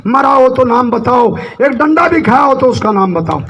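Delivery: full-voiced political speech, phrase by phrase; forceful stump-speech delivery; rally oratory. Real, not acted. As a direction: address a big crowd in emphatic, sustained bursts.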